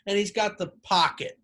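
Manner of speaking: usual speaking voice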